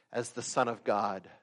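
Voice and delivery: usual speaking voice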